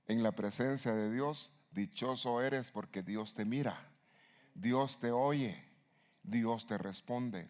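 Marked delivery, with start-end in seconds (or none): 1.7-7.5 slow blessing. Acted. Preacher's slow emphatic blessing.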